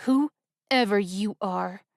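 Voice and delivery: slow words through clenched teeth. Acted. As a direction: grit it out slowly. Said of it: "spoke through clenched teeth".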